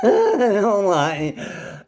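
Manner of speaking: high, loud burst of laughter; real high-pitched laughing outburst. Real, not acted. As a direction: laugh hard through the words.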